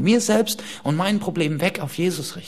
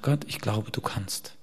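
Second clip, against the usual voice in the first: quiet prayer; 4 semitones lower, 7 dB quieter, slower than usual. Retold or imitated. Quoting Abraham's quiet prayer.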